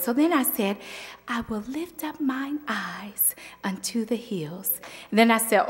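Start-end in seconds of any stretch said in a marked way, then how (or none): 1.3-4.8 soft breathy whisper of the verse; softer, sweeter voice. Retold or imitated. Youth speaker tries a soft sweet voice, whispered. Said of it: "use a little softer and sweeter voice".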